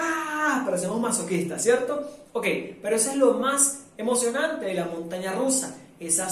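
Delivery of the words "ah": high scream of the drop; thrill-ride scream again; rollercoaster scream. Shown as a demonstration. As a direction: shriek as the car dives. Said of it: "y volvemos otra vez".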